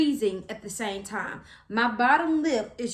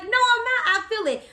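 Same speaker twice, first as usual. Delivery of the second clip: high, loud, exasperated retort; insistent protest; 11 semitones higher, 4 dB louder than usual. Retold or imitated. Patient arguing epidural wore off, insistent high protest. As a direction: protest with pitch shooting up.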